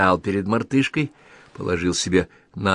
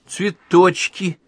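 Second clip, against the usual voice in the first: put-on small girl voice; 10 semitones higher, 4 dB louder, much slower than usual. Anechka guessing game, child voice.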